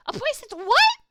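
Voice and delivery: loud, high echo of the creature's question; mocking shout. Doctor shouts the creature's question back at it.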